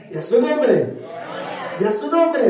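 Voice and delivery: fervent shout of praise; shouted refrain. Real, not acted. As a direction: call it out to the church. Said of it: "shouted refrain".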